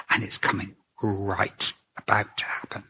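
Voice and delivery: hushed, breathy whisper of dread. Acted. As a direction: drop to a whisper as if afraid to say it. Jesus dreading his coming pain.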